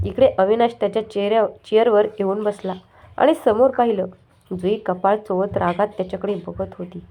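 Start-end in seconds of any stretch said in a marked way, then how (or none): none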